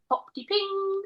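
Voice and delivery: high, sing-song microwave ping; microwave noise. Retold or imitated. Co-host demonstrating the sound a microwave makes, high mimicked ping. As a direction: imitate a microwave's chime. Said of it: "it's basically the sound a microwave makes".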